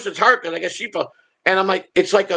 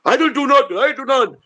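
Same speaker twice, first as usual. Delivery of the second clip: higher-pitched mock plea of innocence; mock protest; 9 semitones higher than usual. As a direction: act out an indignant denial, pitched up high. Acting out the antagonizer's protest.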